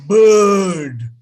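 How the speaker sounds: BIRD with a long "uh" vowel, silent R; loud, drawn-out BIRD as "buhd"; English pronunciation. Shown as a demonstration. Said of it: "is pronounced as".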